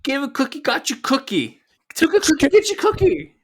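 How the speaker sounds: high, hammed-up sing-song cookie bit; mock New Girl quote.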